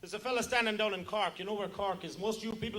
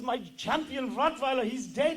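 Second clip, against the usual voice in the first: loud, high, slow German outcry; German accent; 6 semitones higher, 6 dB louder, slower than usual. Acted. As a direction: wail it in a heavy German accent. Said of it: "The German says".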